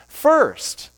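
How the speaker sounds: high, emphatic "first"; stress on first. Shown as a demonstration. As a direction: exclaim "first" as the key word. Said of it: "the word first".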